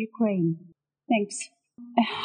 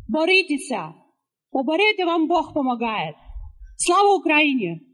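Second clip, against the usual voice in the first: rallying cry; 4 semitones higher than usual. Real, not acted. Rally speaker calling out slogans.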